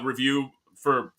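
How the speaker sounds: usual speaking voice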